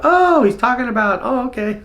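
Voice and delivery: high, delighted "oh, okay"; sudden realization. Retold or imitated. Fan finally catching the Billie Jean lyrics, high and delighted. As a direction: it finally clicks. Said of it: "you go".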